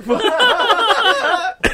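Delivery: high, loud laughing what; stunned laughing what; retold shocked what. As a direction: squeak it out in disbelief, laughing. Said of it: "and I was like".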